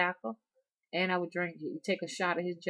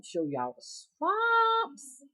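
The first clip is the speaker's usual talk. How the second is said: sing-song; much slower than usual. Acted. Showing swamps, sing-song call.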